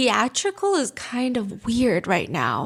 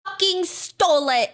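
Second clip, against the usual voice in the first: mock angry outburst; 7 semitones higher than usual. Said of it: "And then the next one".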